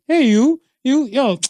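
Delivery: casual, high-pitched "hey you"; calling out. Acted. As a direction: call over someone you half recognize. Acts out greeting a passerby.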